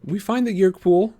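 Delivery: lightly voiced boy. A narrator's reading. Tobias voiced in story.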